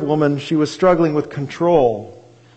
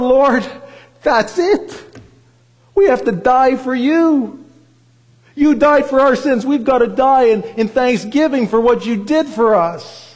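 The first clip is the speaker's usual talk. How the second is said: retold prayer through tears, higher throughout; tearful prayer; 6 semitones higher than usual. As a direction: relive the moment with a touch of weeping. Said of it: "I wept".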